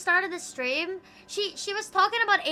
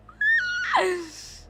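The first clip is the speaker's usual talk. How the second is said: very high, shouted burst of laughter; piercing cackle; shrieking laugh. Real, not acted. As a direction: scream with laughter. Explosive laugh.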